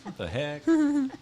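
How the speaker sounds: high, laughing whiny guy voice; imitating the boyfriend. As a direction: whine like a confused boyfriend. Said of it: "he'd be".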